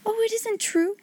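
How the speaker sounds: high, soft, breathless disbelief; a little girl who can't believe her luck; a young girl's hushed, amazed denial; overwhelmed girl. Acted. Sarah's voice, overwhelmed.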